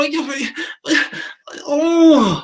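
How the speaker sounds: very high-pitched imitation of exasperated heckler; mock exasperation. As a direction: act out his annoyed, wailing frustration. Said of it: "He was getting exasperated, so annoyed".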